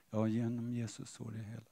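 barely audible, low mumble; mumbling into beard. Acted. Believer asked to repeat the verse, mumbling it low. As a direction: swallow the words, very softly. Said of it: "mumlar i skägget".

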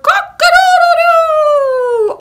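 loud, high, long-held cock-a-doodle-doo; rooster crow. A narrator's reading. Rooster waking the farmer at sunrise, crowing loudly. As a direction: crow at full volume like a rooster. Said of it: "the rooster crowed".

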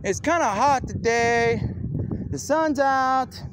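high, put-on voice quoting know-it-alls; mock quote. Mocking what experts say about heat, high voice. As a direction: pitch it up to mock them. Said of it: "So when they say".

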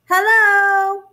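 high, loud, drawn-out call; shouting. A narrator's reading. Bear calls to the moon from the mountains, shouting. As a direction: holler across the mountains. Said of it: "again he shouted".

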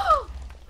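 sharp high gasp; shocked gasp. Real, not acted. Reacting to the fairy nearly dying, shocked gasp. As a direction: gasp in alarm. Friend gasps at near death.

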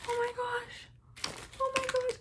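tiny, high-pitched disbelief; stunned squeak. Real, not acted. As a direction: squeak it softly, overwhelmed. Stunned by all the lip balms.

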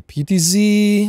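high, slow, drawn-out disbelief; incredulous. Real, not acted. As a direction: stretch it out in disbelief. Browsing vehicles, reacting.

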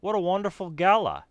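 projected Australian character voice; Aussie accent. Acted. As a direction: gush about it in an Aussie accent. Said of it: "all the males are gonna be Aussie".

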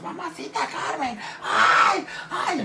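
high, slow wailing plea; crying kids to grandma. Retold or imitated. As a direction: wail to grandma for rescue.

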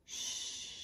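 Sh- breathed voiceless and soft; sh sound. Shown as a demonstration. Teacher showing kids that S and H make sh. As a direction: hush out the sh sound. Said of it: "when S, H are together".

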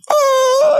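long high mock sob; imitated crying wail. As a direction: howl like a man bursting into tears. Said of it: "he's crying".

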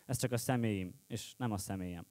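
low and soft, fading away; quiet closing plea. Acted. Slam poet asks audience to judge the person, quietly. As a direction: bring it down to a hushed, low ending.

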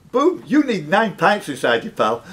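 ordinary delivery

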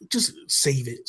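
ordinary delivery

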